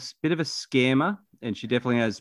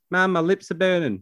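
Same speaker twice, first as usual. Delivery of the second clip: put-on child complaining; 8 semitones higher, 3 dB louder than usual. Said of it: "she goes".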